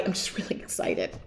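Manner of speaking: soft whisper, low and sheepish; whispered apology. Pretend. Answering a viewer who finds her salesy, sheepish whisper. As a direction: whisper it as a guilty confession. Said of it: "And I'm just gonna say".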